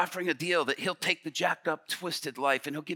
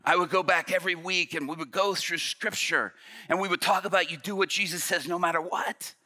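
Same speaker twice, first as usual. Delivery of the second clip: ordinary delivery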